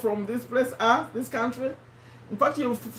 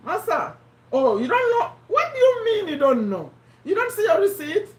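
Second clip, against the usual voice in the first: incredulous; 8 semitones higher than usual. Acted. Mock-scolds viewer over receipts.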